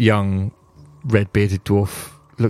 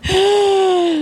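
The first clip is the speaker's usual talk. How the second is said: sudden high hopeful gasp. Acted. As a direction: gasp as if hearing great news. Goliath gasps hopefully.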